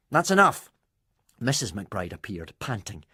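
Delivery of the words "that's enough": stern. A narrator's reading. Mother stops the quarrel.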